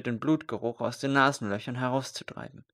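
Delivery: ordinary delivery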